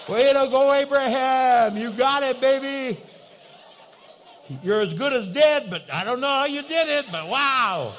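hammed-up praise; mock congratulations. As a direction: slap Abraham on the back with your voice. Preacher cheers Abraham.

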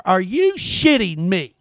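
ordinary delivery

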